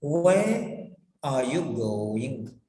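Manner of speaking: very slow, WHERE drawn out and rising; slow question with a rising, stretched WHERE. Shown as a demonstration. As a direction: lift "Where" slowly, then drop for the rest. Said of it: "when you raise the tone".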